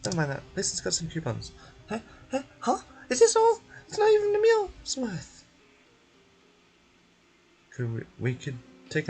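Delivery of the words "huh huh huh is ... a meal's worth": loud indignant little sidekick; high, loud, outraged complaint; high whiny companion. Acted.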